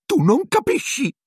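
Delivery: very high, pitiful whine; whimpering Pettigrew. A narrator's reading. Pettigrew protesting to Sirius, high whimper. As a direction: whimper it like a cornered coward. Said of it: "piagnucolò Pettigrew".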